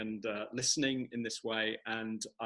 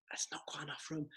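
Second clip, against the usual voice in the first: whisper; 4 semitones higher, 7 dB quieter than usual. Acted. Baby balloon squeezing into bed.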